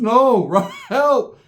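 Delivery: high, loud comic cry for help; hammed-up alarmed holler; mock panic. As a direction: yelp it out, half laughing. Acts out dazed plea for help.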